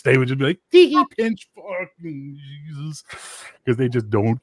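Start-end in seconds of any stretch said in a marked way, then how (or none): 0.7-3.0 mock giggly quote. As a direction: put on a high silly titter, then drag it out. Mocking quote of giggly troublemakers.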